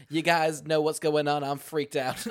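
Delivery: loud, slightly hammed-up flamboyant soldier; lightly mock a flamboyant army man, drawn out and loud; flamboyant military man. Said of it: "He runs over kind of flamboyantly".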